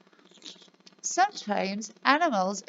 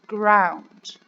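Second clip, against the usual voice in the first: model word; 2 semitones lower, 6 dB louder than usual. Shown as a demonstration. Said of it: "can you say it with me".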